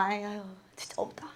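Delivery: soft unvoiced whisper with a sigh; whispering her surrender to God. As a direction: let it go on a whispered breath. Realising she can do nothing.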